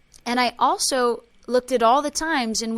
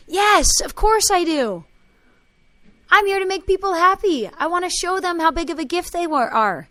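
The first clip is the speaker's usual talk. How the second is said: put-on eager child; 7 semitones higher, 7 dB louder than usual. Child's eager reply in story.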